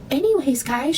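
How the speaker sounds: high, loud mock influencer voice; weird voice. Mocking Chelsea's weird online voice, high and loud. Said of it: "she gets this really fucking weird voice".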